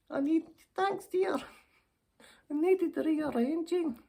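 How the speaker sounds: put-on very high-pitched, twittery old dear; flustered old lady. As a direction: play a coy old lady getting flustered. Old lady thanks the helper.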